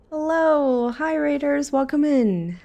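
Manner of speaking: high-pitched, drawn-out hello; excited welcome. Real, not acted. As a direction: greet arriving raiders in a high, bright-pitched call. Streamer greets incoming raiders.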